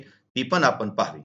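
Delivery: usual speaking voice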